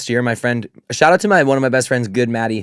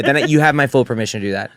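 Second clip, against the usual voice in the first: usual speaking voice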